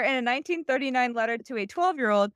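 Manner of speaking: usual speaking voice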